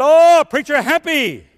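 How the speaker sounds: high, loud, drawn-out protest of joy; exuberant acted reply. Preacher assuring the African man he is happy, exuberant acted reply. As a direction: belt it out, stretching it joyfully.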